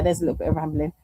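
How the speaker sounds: normal talking voice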